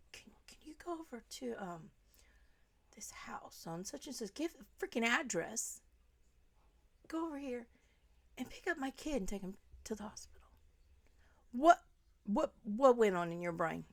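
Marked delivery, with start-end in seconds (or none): 0.1-10.4 mock secretive whisper. Imitating the mother asking someone to fetch the kid, whispered. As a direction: whisper it like a sneaky request. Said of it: "you say".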